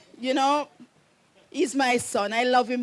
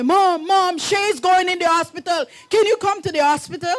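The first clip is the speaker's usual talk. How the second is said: higher, louder, hurried plea; urgent son; 5 semitones higher, 5 dB louder than usual. Retold or imitated. Son phoning his mother as his girlfriend goes into labor, urgent. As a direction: rush it like a panicked son calling.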